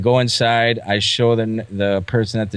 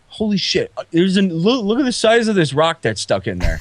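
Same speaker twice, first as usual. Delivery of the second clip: 1 mock shocked doctor; 7 semitones higher than usual. Doctor swearing at the rock.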